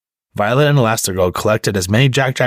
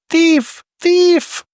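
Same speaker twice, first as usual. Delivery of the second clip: high, loud alarmed yell; shouting thief; 4 dB louder than usual. A narrator's reading. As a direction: scream it across the mall. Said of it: "A woman ... shouted".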